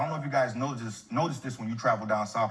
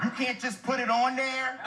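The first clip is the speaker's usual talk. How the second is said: higher, slow, outraged disbelief; a pitched-up mock customer whining at the counter; indignant customer; 12 semitones higher, slower than usual. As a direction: play the lady stunned there's a cheese charge. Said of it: "She went".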